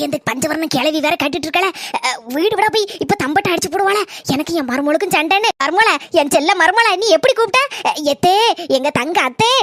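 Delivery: ordinary talk, nothing marked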